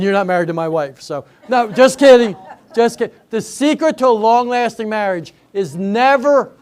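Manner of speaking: normal talking voice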